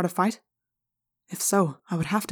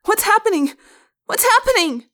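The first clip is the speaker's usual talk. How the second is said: high frightened shouting; panicked scream; 6 dB louder than usual. A narrator's reading. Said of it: "began screaming".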